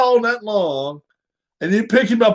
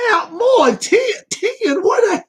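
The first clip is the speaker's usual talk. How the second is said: incredulous outburst; 13 semitones higher, slower than usual. Real, not acted. Outraged by dog weight claim.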